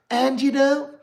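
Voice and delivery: high, prim imitation of proper speakers; mock proper talk. Mocking the proper speakers at the award, high and prim. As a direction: put on a posh high voice to mimic them. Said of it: "they were talking so proper".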